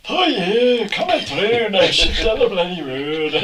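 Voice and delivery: high, loud Scottish voice in the style of Billy Connolly; Billy Connolly impression. Mates riffing on a talking car, done in a loud Scottish accent. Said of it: "you could have Billy Connolly talking".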